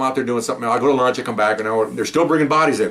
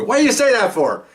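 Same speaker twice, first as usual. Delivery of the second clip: higher, louder rebuke; scolding; 12 semitones higher, 4 dB louder than usual. Retold or imitated. Officer scolds colleague for cruel joke, higher louder rebuke. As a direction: snap at a colleague for saying that.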